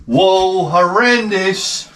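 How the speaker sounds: climbing from high to very high, slow, hammed-up gagging disgust; disgusted outcry. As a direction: drag out the horror as if about to retch. Stepping in dog mess.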